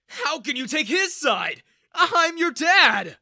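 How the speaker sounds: high, very loud, slow indignant shout; outraged father. A narrator's reading. Father shouts at his daughter for taking sides, outraged. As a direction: shout out the betrayal.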